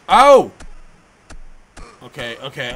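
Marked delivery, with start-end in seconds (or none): 0.1-0.5 sudden loud cry of surprise; loud startled shout. Real, not acted. Gamer reacting to a big punch on screen, loud and startled. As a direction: blurt it out, caught off guard.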